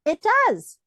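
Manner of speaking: high, loud enthusiastic yes; emphatic agreement. Retold or imitated. Coach confirms to clients, emphatic. Speaker affirms clients' excitement.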